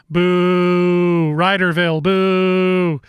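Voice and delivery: playful boos, loud at each boo; mock booing. Host teasing the rival city with mock boos. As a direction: jeer at the rival town in fun.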